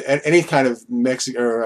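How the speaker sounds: usual speaking voice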